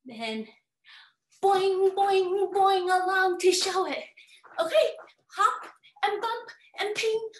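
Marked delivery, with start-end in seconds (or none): 1.4-4.0 louder bouncy boings; boing along like a kangaroo. Acted.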